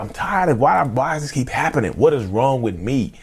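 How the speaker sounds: high-pitched exasperated self-questioning; reliving frustration, pitch pushed well up; fed-up plea for an answer; frustrated. Retold or imitated. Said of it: "old enough to be frustrated".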